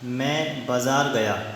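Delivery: slow, careful example sentence; slow example read. Shown as a demonstration. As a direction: read it out slowly for students. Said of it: "पहला sentence है". (धीरे-धीरे उदाहरण पढ़ना)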